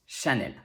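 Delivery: Chanel starting with sh, ending in "el"; French pronunciation. Shown as a demonstration. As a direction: say Chanel the French way, "sha-NEL". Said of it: "now you know it's pronounced".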